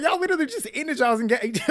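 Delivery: high, loud, disbelieving; incredulous protest. Real, not acted. Recapper addressing the split couple, high incredulous protest. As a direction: pitch it way up in amused disbelief.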